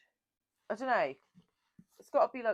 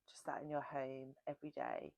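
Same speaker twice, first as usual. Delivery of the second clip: dreamy whisper; 2 semitones lower, 10 dB quieter than usual. Real, not acted. Dreamy whisper about accent.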